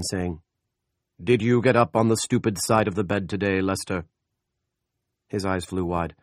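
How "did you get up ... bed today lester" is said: God's voice. A narrator's reading. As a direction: scold him like a mother from heaven. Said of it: "The Lord spake unto him again".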